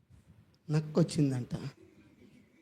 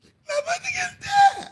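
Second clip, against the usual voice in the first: mock wail; much slower than usual. Woman lamenting, cursing herself.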